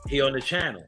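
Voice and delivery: ordinary talk, nothing marked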